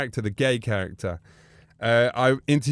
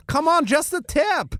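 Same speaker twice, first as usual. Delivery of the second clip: much higher coaxing Austrian voice; Bruno voice; 13 semitones higher than usual. Retold or imitated. Bruno coaxing the wrestlers, in a high coaxing voice. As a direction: wheedle like a flirty Austrian. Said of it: "They go".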